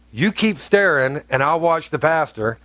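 ordinary talk

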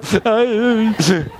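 high whimper, gasping for air; hammed-up overcome weeping; mock gasping sob. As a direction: imitate someone fanning back tears. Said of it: "this woman who needed some air".

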